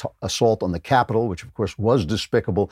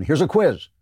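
ordinary talk, nothing marked

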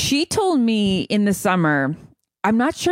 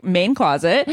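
usual speaking voice

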